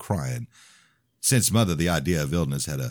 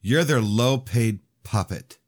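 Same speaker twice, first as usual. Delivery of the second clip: sad; 7 semitones higher, 3 dB louder, slower than usual. Acted. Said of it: "she had said it so sadly".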